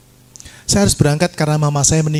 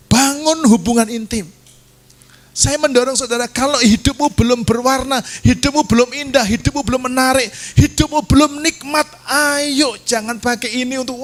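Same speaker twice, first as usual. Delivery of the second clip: high-pitched urgent exhortation; preaching at full force; 10 semitones higher than usual. Real, not acted. Preacher urges congregation toward closeness with God, forceful preaching. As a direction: drive each phrase home in a raised pitch.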